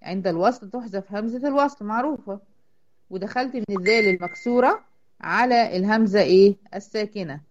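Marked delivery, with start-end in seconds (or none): none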